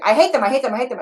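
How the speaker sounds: mock angry repetition; angry protest. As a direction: vent it as an angry inner part. Voicing an angry firefighter part.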